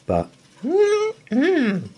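high, loud happy hum; delighted mm-mm. Acted. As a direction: hum with satisfaction. Goldilocks finds right bed.

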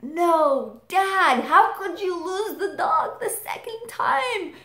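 put-on high teary kid pleading with dad; distraught kid. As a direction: wail at dad like a crushed four-year-old. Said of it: "And I'm like".

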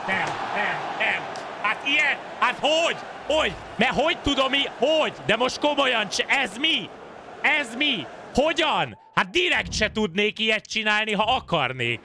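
high, incredulous gamer rant; exasperated disbelief. Real, not acted. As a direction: complain high at the game. Real disbelief at the game.

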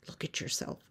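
hushed, whispered rebuke; soft, voiceless and confidential; speaking in her ear. Acted. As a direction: murmur it close to the ear. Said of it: "speaks in her ear".

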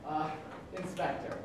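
normal talking voice

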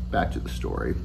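hushed, half-whispered return to the tale; soft aside. Real, not acted. Author to listeners, near whisper. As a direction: drop to a near whisper to get back to it.

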